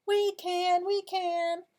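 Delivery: high, bouncy chant; eager helpers' answer. Acted. Animals answer the stuck duck, high chanted reply. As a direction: chime in high and loud for kids to join. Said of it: "what do you think the moose say".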